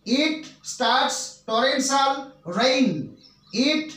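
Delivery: dragged out word by word for copying; slow classroom dictation. Shown as a demonstration. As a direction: read slowly so students can write it. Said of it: "এরপর আমি বলতে পারি".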